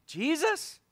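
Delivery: high, incredulous question; playful disbelief; mock surprise. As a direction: sound shocked at the idea.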